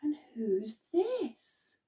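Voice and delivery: slow whispered question; hushed reveal. Acted. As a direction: tease the children with a secret. Teasing reveal of a picture.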